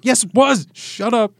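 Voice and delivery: high, loud defensive outburst; defensive yell. Pretend. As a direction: snap back, caught out. Gamer snapping back at app.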